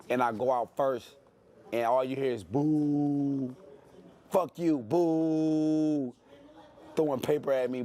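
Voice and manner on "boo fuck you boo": slow drawn-out imitation of a booing crowd; imitating a jeering crowd, drawn out and slow; mock crowd booing. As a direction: stretch out the boos like a jeering stadium. Imitating hostile stadium crowd.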